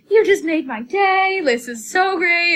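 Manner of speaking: high, loud, slow gushing; retold tearful fan. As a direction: imitate an overwhelmed fan, stretching the words. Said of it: "like, cries".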